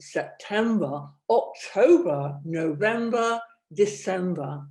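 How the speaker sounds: loud months through a cork; cork in mouth. Shown as a demonstration. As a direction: say the months biting a cork, with range. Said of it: "So, cork in the mouth".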